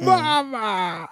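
high drawn-out mock cry; mock wail. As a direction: wail it out, long and falling. Mimicking the film's wailing cry.

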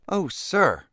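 high, loud protest; bursting out. A narrator's reading. Accused boy to the governor, bursting out in protest. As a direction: burst out pleading innocence. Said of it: "burst forth Freckles".

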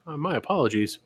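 soft, sheepish mock apology; hushed, over-polite contrition; polite creep. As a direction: apologize meekly and courteously.

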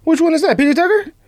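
squeaky high-pitched mocking voice. Mocking his friend in a squeaky high voice. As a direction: pitch it way up, teasing.

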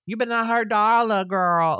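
high, sassy warning to protect Darla; sassy Isaac voice. Retold or imitated. Narrator demoing her Isaac voice for the co-host, high and sassy. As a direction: sass it out like a reality star. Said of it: "a mixture between him and Jack A, Harry from Sister Sister".

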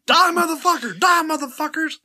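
high shouted command; battle cry. Pretend. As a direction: yell it like a charge. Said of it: "when I say, "Die, motherfucker".

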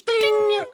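high squeaky ding; typewriter ding. Pretend. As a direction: chirp it like a typewriter bell. Typewriter bell imitated.

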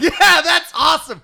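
high, loud cheer through laughter; gleeful cheer. Real, not acted. Rejoicing that his friend lost at pickleball, gleeful cheer. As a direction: whoop it out, laughing with delight.

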